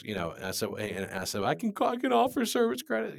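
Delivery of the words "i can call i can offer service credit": much higher squeaky self-mockery; mock small voice. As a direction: play the timid son echoing dad.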